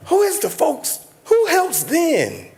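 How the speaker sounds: high, slow, desperate plea of a mother; pleading woman. Retold or imitated. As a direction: voice her begging for help. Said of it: "She looked me in my face and said".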